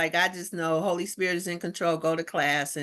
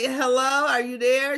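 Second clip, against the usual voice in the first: mock calling out; 5 semitones higher, 5 dB louder than usual. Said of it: "I'm like".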